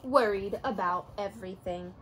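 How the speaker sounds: usual speaking voice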